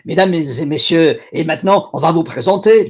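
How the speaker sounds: loud showman's French announcement; French barker. Pretend. Clown barker opening the show in French, loud and showy. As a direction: call out to the crowd like a French barker. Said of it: "subtitle myself as this mad bloke".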